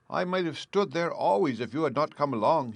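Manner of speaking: grateful Tin Man. A narrator's reading. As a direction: thank them like they saved your life.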